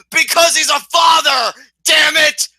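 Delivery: loud, very high hammed-up outburst; mock-indignant shout. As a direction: holler it in mock outrage. Mock-indignant aside about Kevin as father.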